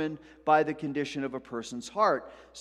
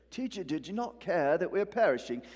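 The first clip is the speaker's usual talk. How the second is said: put-on British Bible-movie accent; mock British; 3 semitones higher than usual. Preacher parodying Bible-movie disciples, mock British. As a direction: play a polite British disciple, hammed-up. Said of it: "I don't know why they're British".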